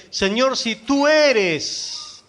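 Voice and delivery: acted call across the water, high and drawn out; Pedro. Pedro calling to the Lord from the boat, acted call. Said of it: "Pedro le dice".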